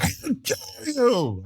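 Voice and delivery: mock the hosts' outrage, stretched out; mocking quote. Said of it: "they were like".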